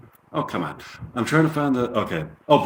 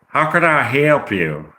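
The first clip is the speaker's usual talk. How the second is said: slow Southern waitress taking an order; Southern accent. Acted. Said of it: "[name] gets a Southern accent".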